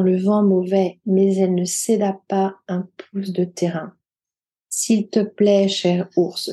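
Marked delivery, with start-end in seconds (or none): none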